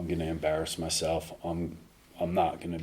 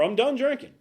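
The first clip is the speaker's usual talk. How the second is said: mock sincerity; 5 dB louder than usual. Ironic claim, high pitch.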